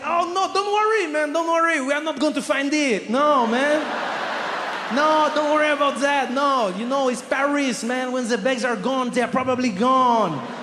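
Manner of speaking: put-on high, loud French-accented nonchalance; cool French guy. French clerk dismissing hope for lost bags, breezy French accent. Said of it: "but very French, very French, cool guy".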